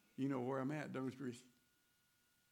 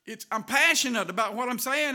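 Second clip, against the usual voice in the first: ordinary delivery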